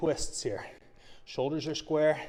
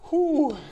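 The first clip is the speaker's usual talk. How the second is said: relieved whew; 14 semitones higher, 9 dB louder than usual. Real, not acted. Exhale after a hard set.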